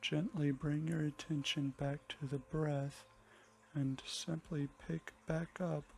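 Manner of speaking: soft, unhurried meditation voice with long pauses; hushed and calm, easing listeners into the breath; guided meditation. Acted.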